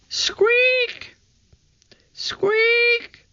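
very high-pitched pretend mouse; piping mousy squeal; mouse squeak. A narrator's reading. Children imitate a mouse squeak.

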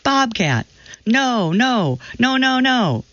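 high, alarmed mock yell. A narrator's reading. Startled sunbather at a bobcat near her cat, understated high yell. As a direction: cry out in alarm without much volume. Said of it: "let out a screech before yelling".

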